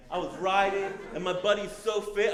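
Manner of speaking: ordinary delivery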